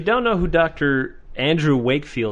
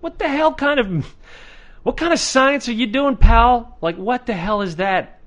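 high-pitched incredulous challenge; exasperated, voice pitched way up; 9 semitones higher than usual. Acted. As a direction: demand an answer in high disbelief. Mock-challenges the discredited researcher.

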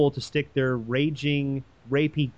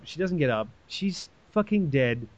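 ordinary delivery

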